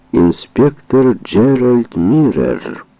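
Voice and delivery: slow, loud formal call; loud announcement. Acted. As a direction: call the witness in. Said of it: "громко произнёс секретарь".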